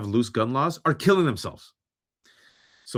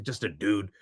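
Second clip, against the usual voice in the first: normal talking voice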